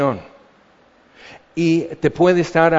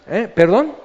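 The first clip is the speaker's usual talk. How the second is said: a high, louder "¿Eh? ¿Perdón?" as if caught off guard; startled, as if you missed what was said; mock surprise; 7 semitones higher, 4 dB louder than usual. Distracted listener caught off guard when God speaks, mock surprised.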